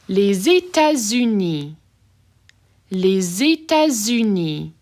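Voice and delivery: les États-Unis linked as lay-zay-tah-zoo-NEE, slow; French liaison. Shown as a demonstration. Teacher demonstrating liaison for students, slow careful French. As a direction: link les into États with a Z, slowly. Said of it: "you have a liaison happening here".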